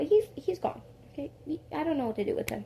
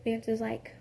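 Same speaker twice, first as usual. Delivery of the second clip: ordinary talk, nothing marked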